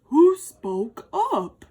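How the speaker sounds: elephant voice. A narrator's reading. Elephant asks.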